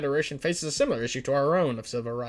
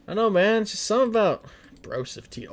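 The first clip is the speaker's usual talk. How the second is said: ordinary talk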